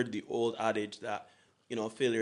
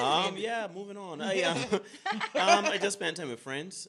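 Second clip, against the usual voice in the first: embarrassed laugh; 9 semitones higher than usual. Real, not acted. Embarrassed about new year.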